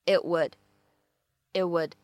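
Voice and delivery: "it would" joined, T in "it" held unreleased; incomplete plosion. Shown as a demonstration. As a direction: hold back the T of "it" into "would". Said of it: "我们可以读成是it would".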